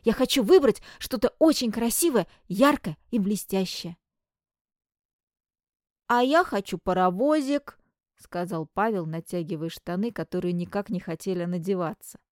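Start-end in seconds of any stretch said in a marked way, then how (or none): 0.0-4.0 excited. Acted. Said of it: "возбуждённо ответил Клеон". 6.1-7.8 put-on little boy voice. Small brother's wish.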